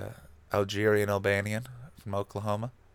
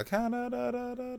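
weird native voice; 14 semitones higher than usual. Pretend. Holding up his passport, wailing Canada high and strange. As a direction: wail it high like a strange ritual chant. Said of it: "that weird native voice".

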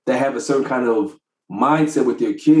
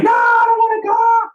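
put-on very high wailing child's no; imitating daughter screaming and crying; mimicked screaming child. Dad mimics daughter crying at school drop-off, high wail. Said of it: "she would scream crying".

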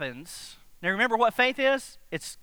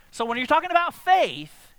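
usual speaking voice

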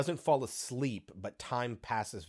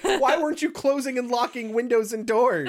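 excited agreement; 14 semitones higher, 10 dB louder than usual. Retold or imitated. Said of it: "Kelsey was like".

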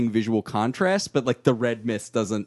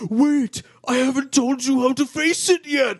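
put-on frantic old man; 12 semitones higher, 4 dB louder than usual. Voicing the old man's warning.

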